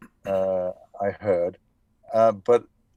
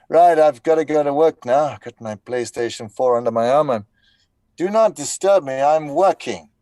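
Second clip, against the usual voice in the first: louder, higher announcement; mock self-important dad; 4 semitones higher, 7 dB louder than usual. Father telling wife and kids not to disturb him, mock grand. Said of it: "saying to my wife and my children".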